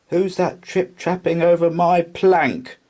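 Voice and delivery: high, loud mocking jeer. A narrator's reading. Pirate chief taunting the Troll on the plank, loud jeer. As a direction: taunt him in a sing-song jeer. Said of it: "jeered Hank Chief".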